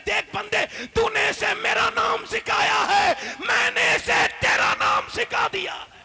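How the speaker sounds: high, slow, shouted pronouncement; booming divine proclamation. Acted. God addressing the parent who taught His name, booming slow shout. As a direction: thunder it out like a voice from heaven. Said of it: "اور یہ کہتا ہے".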